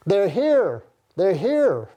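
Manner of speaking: retold shout. Prisoner relaying screams of arrival through the hallways, retold shout. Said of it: "they were shouting".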